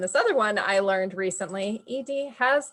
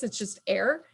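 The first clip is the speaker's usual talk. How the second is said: usual speaking voice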